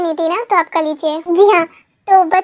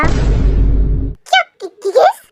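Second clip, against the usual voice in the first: shocked stammer; 3 semitones higher, much slower than usual. Acted. Shocked at kiss bet.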